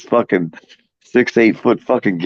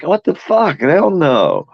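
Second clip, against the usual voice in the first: high, startled hell no; hammed-up shocked hell no; mock shocked refusal; 8 semitones higher, much slower than usual. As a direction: recoil in mock horror. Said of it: "I'm like".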